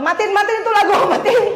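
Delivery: laughing while snapping turn it off; irritated mock order. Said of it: "Sewot banget gitu". (perintah kesal setengah bercanda sambil tertawa)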